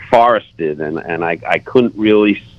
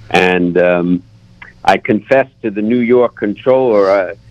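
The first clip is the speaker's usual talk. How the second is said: ordinary talk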